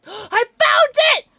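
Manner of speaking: very high, loud, stretched-out squeal; excited shriek. Real, not acted. Player finally finding the key, shrieking with excitement. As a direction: scream it in triumph.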